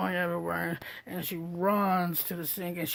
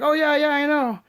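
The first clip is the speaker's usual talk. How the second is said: Mary's casual reply; 7 semitones higher, 9 dB louder than usual. Retold or imitated. Voicing Mary, casual reply.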